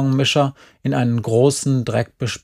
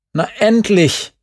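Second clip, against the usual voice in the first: high, raised-voice call; impatient call; 8 semitones higher, 4 dB louder than usual. A narrator's reading. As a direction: call out, fed up with waiting. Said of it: "rief er". (ungeduldig rufen)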